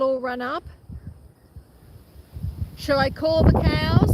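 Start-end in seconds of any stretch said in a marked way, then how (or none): 2.8-4.1 loud call across the paddock. Real, not acted. Farmer calling out to her cows on the hill.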